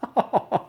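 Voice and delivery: short burst of disbelieving laughter; incredulous laugh. Real, not acted. Vlogger laughs in disbelief at the Miss France quiz, short incredulous laugh. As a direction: laugh at a ridiculously easy question. Stunned by absurd quiz question.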